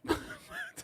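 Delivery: a high, delighted laugh. Real, not acted. Host cracking up at caller's roast, high laugh.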